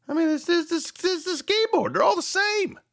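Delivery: high astonished disbelief; incredulous. Real, not acted. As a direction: squeak out the puzzlement. Puzzling over the keyboard.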